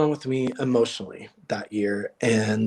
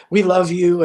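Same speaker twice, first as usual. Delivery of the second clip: raised, louder imitation of cheering fans; mild mock shout, higher and louder; retold crowd shout; 5 semitones higher, 5 dB louder than usual. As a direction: call it out like a supportive audience, lightly. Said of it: "people started shouting out".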